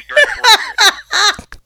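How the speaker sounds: laughing roast. Real, not acted.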